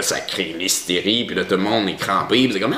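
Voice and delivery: ordinary talk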